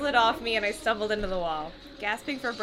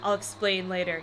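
ordinary talk, nothing marked

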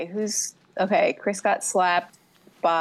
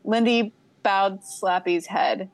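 ordinary talk

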